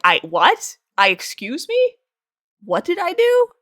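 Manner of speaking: taken aback, rising to a high question; retold outraged bafflement, climbing in pitch; indignant disbelief. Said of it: "I was so mad".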